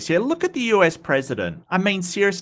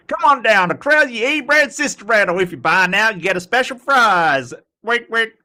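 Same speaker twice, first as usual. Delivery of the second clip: hammed-up American southern accent, higher and louder, like a cheesy TV ad; 5 semitones higher, 4 dB louder than usual. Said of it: "I'm trying to do a southern voice".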